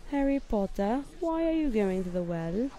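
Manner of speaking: hammed-up London accent, starting high; Harry Potter London accent. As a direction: call out like a Harry Potter character. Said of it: "I'm doing a Harry Potter one".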